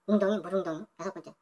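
ordinary talk

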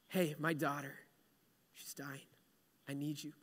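low, very soft, near-whispered appeal; acted grief-stricken murmur; hushed desperate plea. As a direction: plead quietly, fading into a whisper. Jairus pleads for his dying daughter.

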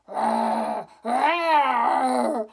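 loud roaring growl. Shown as a demonstration. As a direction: let the growl come close and loud. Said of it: "it got louder and louder".

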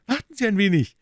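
high, quick eager call. A narrator's reading. Detective stops Holmes from leaving, calling out eagerly. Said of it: "rief Gregson eifrig".

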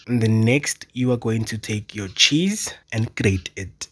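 usual speaking voice

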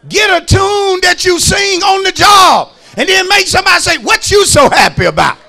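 loud, high, rhythmic sermon chant; shouted preaching. Acted. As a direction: drive every line home over the music. Preaching at full force.

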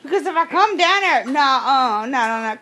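ordinary talk, nothing marked

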